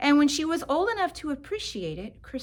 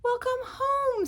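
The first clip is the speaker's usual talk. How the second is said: much higher, light mother's greeting; mother's welcome; 13 semitones higher than usual. A narrator's reading. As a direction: greet her sweetly, high. Mother greets child at home.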